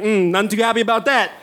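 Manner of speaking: lightly voiced unhappy parents; disapproving parents. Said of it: "his parents ... were like".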